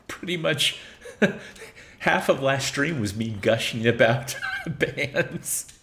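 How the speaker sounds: slow, amused telling broken by laughter; laughing. Real, not acted. Streamer admits gushing about bands to viewers, laughing. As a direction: laugh through the confession.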